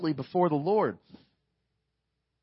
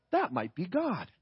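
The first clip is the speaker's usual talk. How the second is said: softer, lower, dawning on him; hushed realization; 3 semitones lower, 5 dB quieter than usual. Retold or imitated. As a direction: let it dawn quietly, under the breath. Eli realizes it is God.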